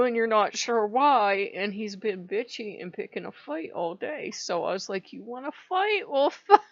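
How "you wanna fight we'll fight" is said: higher mock-belligerent dare breaking into laughter; half-laughing re-enactment of squaring up; retold challenge. Speaker challenging moody Odin to fight, mock bravado.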